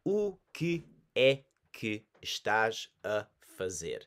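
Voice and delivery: slow, clear, every syllable given; slow and careful. Shown as a demonstration. Teacher slowing down for beginners, slow and clear. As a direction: say it slowly for learners. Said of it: "Again, I'll say nice and slow".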